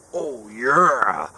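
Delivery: mock booming mascot cheer, voice swooping up and down; imitated big rowdy "oh yeah"; Kool-Aid Man. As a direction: holler it like an over-hyped drink mascot dad. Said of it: "like the Kool-Aid man".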